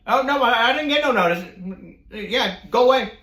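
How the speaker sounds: high, loud mock-whine of refusal; roommate's indignant protest. Retold refusal at the door.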